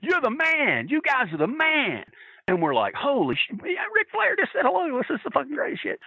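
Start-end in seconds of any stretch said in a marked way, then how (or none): 0.0-2.0 high, drawn-out mimicked praise; imitation of a thrilled champion hailing his fans; Ric Flair. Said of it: "he points at it and goes". 2.9-6.0 high-pitched mock awe; re-enacted fan thrill; retold starstruck excitement. Acting the fans' starstruck reaction.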